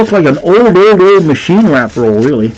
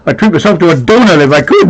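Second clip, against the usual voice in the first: ordinary delivery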